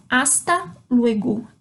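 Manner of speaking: Hasta luego slowly, Hasta with no H sound; silent H. Shown as a demonstration. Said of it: "eu não pronuncio o H". (Hasta luego devagar, sem pronunciar o H)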